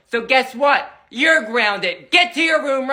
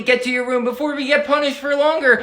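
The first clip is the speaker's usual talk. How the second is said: normal talking voice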